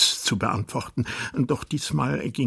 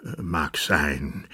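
grudging; 4 semitones lower, slower than usual. Acted. King grudgingly concedes.

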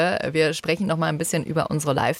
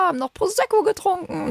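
mock high voice; 14 semitones higher than usual. Mocking the fans sweetly.

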